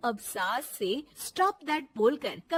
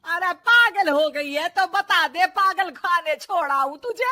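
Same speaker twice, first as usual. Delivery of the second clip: loud, raised angry scolding; furious mother-in-law; 7 semitones higher, 13 dB louder than usual. Acted. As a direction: tear into the daughter-in-law. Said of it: "सास का पारा फिर सातवें आसमान में".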